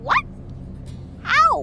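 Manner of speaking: shocked how. Acted. Shocked at news.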